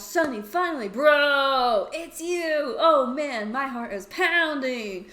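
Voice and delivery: loud, high excited rush of relief; overjoyed. Acted. Toby finding missing friend, loud excited relief. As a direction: burst out with loud joy.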